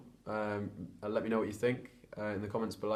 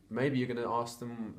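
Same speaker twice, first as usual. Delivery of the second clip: normal talking voice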